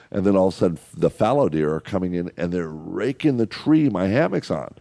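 ordinary talk

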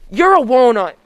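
loud scolding insult; annoyed insult. Acted. Insulting the prank caller.

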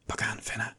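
soft hushed whisper. A narrator's reading. Lijf secretly handing Fenna a knife, soft whisper. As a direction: whisper so the captors can't hear. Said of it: "fluistert Lijf".

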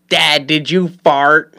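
drawn-out, slow mock kid question; mimicked kid asking. Son asks dad in the store, drawn-out mock kid voice. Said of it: "he'll go".

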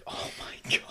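voiceless whisper of amazement; retold hushed astonishment; awed whisper. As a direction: whisper it as if stunned by the sight. Retelling own amazed reaction.

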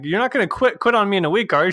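high, playful mock-threat; half-joking warning. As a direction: pitch it up like a nervous plea. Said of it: "I'm like".

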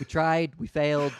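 upbeat shrug, we failed; mock resigned. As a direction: echo it like a defeated team. Echoing the quitters.

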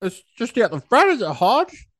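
high mock sceptical voice; imitating Del's disbelieving tease; mocking mate. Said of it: "He was like".

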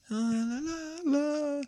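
soft, high wordless la-la; groggy waking noise. Retold or imitated. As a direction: imitate waking up gently, very quietly. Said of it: "I wake up like".

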